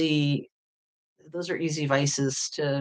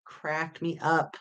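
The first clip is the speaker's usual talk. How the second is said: usual speaking voice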